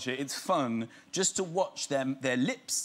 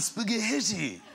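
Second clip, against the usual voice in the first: posh Prince Charles; 4 semitones higher, much slower than usual. Retold or imitated. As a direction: stretch out "spaghetti" like a posh royal. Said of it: "in a way that was so posh".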